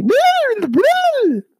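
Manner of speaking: squeaky high slow creature noise; lemming squeak. Acted. Mimics lemming mid-sentence.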